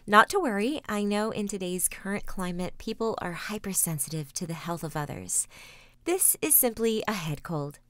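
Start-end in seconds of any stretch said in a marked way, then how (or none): none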